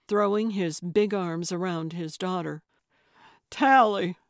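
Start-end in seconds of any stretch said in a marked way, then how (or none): 3.5-4.2 louder cry of her name; father crying out his daughter's name; emotional cry. A narrator's reading. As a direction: call out her name overcome. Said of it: "he cried".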